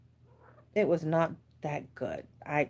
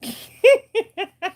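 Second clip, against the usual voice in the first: burst of laughter; 11 dB louder than usual. Real, not acted. Pet licks her face.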